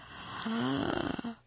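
long audible inhale; deep breath in. A narrator's reading. Olivia readies to blow out candles, a deep breath. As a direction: suck in a big, noisy breath. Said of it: "she took a deep breath".

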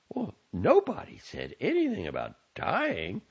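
high, indignant mother; mother answering her son's teasing. A narrator's reading. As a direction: put him in his place. Mother protests.

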